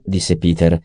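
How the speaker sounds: ordinary delivery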